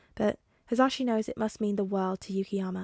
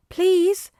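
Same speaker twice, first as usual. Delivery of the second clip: put-on high, loud child plea; begging little boy; 9 semitones higher, 9 dB louder than usual. A narrator's reading. Boy begs his mother, pleading child. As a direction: beg like an eager small boy.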